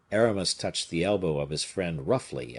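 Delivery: ordinary delivery, nothing marked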